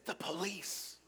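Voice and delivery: put-on hushed child's whisper; whispering little boy. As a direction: whisper it like a small boy hiding. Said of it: "He was whispering".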